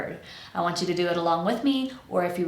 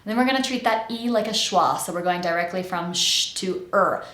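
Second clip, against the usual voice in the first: usual speaking voice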